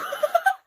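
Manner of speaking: squealing laugh; high burst of laughter. Real, not acted. Reader cracking up at the fanfic's line. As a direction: let a shrill giggle escape.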